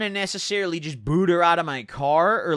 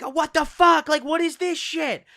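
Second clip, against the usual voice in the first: high-pitched imitation of her outburst; mock yelling; 11 semitones higher than usual. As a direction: mimic her shocked outcry way up high. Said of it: "let out, like, a yell".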